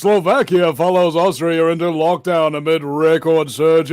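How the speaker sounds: loud, punchy news-announcer read; mock newsreader. Reading a lockdown headline to listeners, mock newsreader voice. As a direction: belt out the headline like an anchor. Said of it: "In more totalitarian news".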